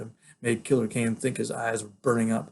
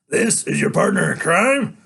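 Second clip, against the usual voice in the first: higher, louder, slow sneering villain; a killer mocking his captive; 7 semitones higher, 8 dB louder than usual. Killer Kane mocking the boy, slow, higher and louder.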